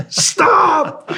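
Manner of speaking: high, loud drawn-out stop; shout. Retold or imitated. Guard yells at his armed team to stop, high and loud. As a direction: yell to halt them.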